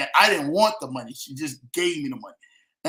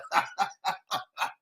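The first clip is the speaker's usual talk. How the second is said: breathy wheezing laugh; 2 semitones higher, 6 dB quieter than usual. Real, not acted. Laughing about taxes and jail.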